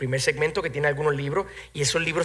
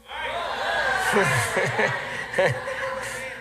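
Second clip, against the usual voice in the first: breathy laugh; 2 semitones higher than usual. Real, not acted. Laughs at own joke.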